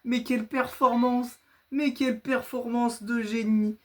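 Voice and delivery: high, slow gloating praise; hammed-up self-congratulation; mock grandiose boast. Boasting after a win.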